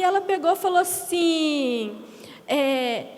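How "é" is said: ordinary talk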